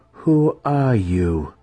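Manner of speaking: curious matron. A narrator's reading. Matron questioning the suspected impostor, curious. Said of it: "her voice sounding more curious than concerned".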